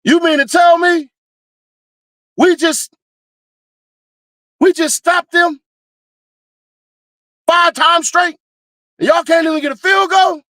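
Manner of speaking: furious football fan ranting at the offense; outraged fan. Retold or imitated. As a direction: yell at the team in disbelief. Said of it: "I'm far too emotional".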